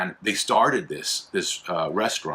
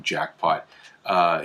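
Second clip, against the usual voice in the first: ordinary delivery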